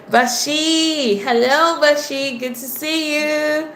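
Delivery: high, drawn-out happy hello; delighted singsong welcome; excited greeting. Real, not acted. Greeting a returning viewer.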